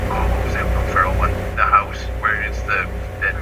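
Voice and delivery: usual speaking voice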